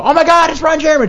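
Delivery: high, loud mock fan squeal; excited fan. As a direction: shout it like a star-struck fan. Fan spotting star at airport.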